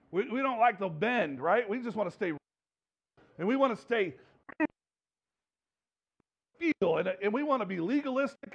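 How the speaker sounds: ordinary delivery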